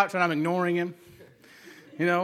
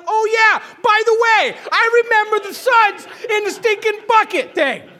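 high, loud, half-laughing rant; laughing angry yell; 14 semitones higher, 8 dB louder than usual. Retold or imitated. Dad remembers the soapy bucket mid-scolding, laughing yell. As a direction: remember the suds and blow up again. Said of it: "I was like".

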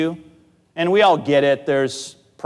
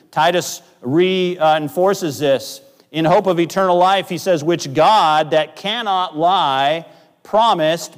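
slow, raised proclaiming voice; preaching scripture; 6 semitones higher, 5 dB louder, slower than usual. Acted. Pastor reading Titus to the congregation, slow and raised. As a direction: read it like a proclamation, slowly.

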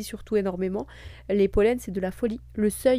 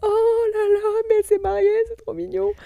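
gushing; 11 semitones higher, 6 dB louder than usual. Retold or imitated. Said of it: "j'étais là en mode".

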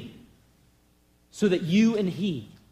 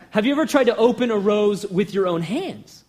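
ordinary talk

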